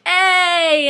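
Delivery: high, loud, drawn-out welcome; happy greeting. Retold or imitated. Singer greeting a fan walking in, loudly happy. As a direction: greet a fan with big open excitement. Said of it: "he was so happy".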